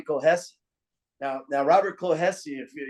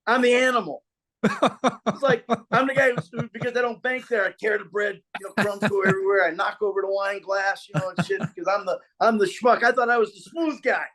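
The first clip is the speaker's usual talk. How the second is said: amused disbelief; 7 semitones higher than usual. Real, not acted. To his host, laughing at being cast as the animal. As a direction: voice climbing high, laughing at himself. Excited retelling of being schmuck.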